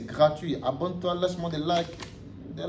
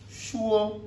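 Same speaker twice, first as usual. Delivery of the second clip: "Shuo" held high and level; "Shuo" high and steady, no fall; first-tone demo; 7 semitones higher than usual. Shown as a demonstration. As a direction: sustain "Shuo" on one high pitch. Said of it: "premier temps".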